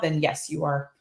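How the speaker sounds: ordinary delivery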